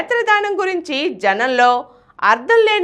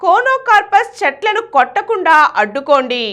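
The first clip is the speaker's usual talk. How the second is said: headline read; 4 semitones higher than usual. Acted. Story title read as headline.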